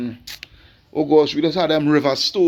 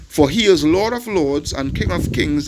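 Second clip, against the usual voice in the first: ordinary delivery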